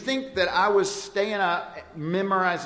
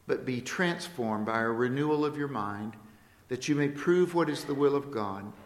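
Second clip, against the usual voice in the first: soft, reverent reading of scripture; scripture recitation; 4 dB quieter than usual. Acted. Preacher reciting Romans twelve to the church, soft and reverent. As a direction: read it as a quiet offering.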